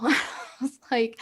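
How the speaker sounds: usual speaking voice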